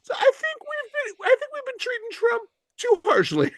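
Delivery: very high, put-on mock voice; squeaky-high mock political shill; mock propaganda mouthpiece. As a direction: pipe up in a silly high voice as a badly disguised stooge. Mock foreign asset giving himself away.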